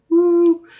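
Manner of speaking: high loud party whoop; huge high-pitched woo; excited whoop. Real, not acted. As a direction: let out a big celebratory woo. Celebratory whoop.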